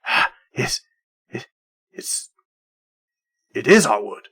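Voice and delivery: lightly voiced growling creature; halting growl back from the fog. Growl answering a call in the woods, a growling voice. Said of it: "this growl come back to you".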